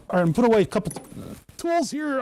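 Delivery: hiccups breaking the words; talking through hiccups; hiccuping. Real, not acted. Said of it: "I'm hiccuping".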